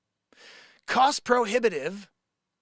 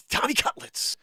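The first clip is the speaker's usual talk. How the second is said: whisper; 5 semitones higher than usual. Acted. Whispers nickname before impression.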